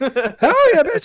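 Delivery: real laughing shout; laughing cheer. Real, not acted. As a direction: whoop it out while laughing. Laughing outburst.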